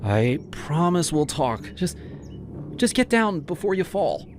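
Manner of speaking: a touch of fearful calm; scared reassurance. Acted. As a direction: talk him down. Ava soothing, scared.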